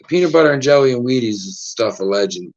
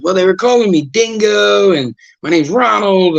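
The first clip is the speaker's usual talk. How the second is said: lightly raised silly voice; Ringo explaining; 8 semitones higher than usual. Retold or imitated. Ringo explaining his name, in a goofy higher voice. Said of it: "They were like".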